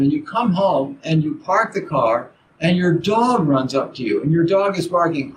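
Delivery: normal talking voice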